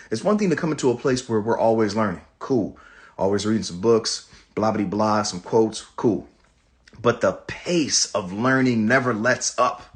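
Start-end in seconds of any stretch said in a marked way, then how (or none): none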